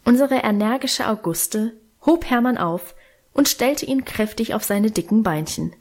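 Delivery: ordinary delivery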